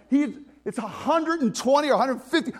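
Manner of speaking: ordinary talk, nothing marked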